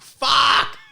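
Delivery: loud, high burst of frustration; exasperated yell. Retold or imitated. Caller fed up with the phone robot, exasperated yell.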